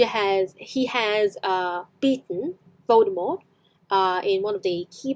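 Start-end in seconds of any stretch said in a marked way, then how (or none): none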